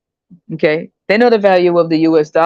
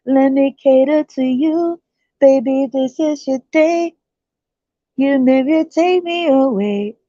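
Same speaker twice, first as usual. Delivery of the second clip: high, slow honeyed put-on voice; sweet seductive woman; 7 semitones higher, slower than usual. Woman promising to cater to the American, high slow coo. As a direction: coo it to him.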